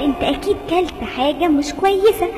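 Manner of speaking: calm doctor. Acted. Doctor telling the rabbit he ate something bad. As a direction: diagnose gently.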